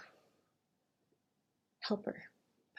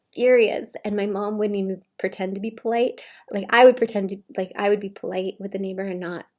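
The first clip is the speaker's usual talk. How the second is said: ordinary talk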